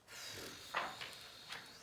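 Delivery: long, soft exhale; weary sigh. Acted. Reader sick of the book, long weary sigh. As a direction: blow out a long exasperated breath.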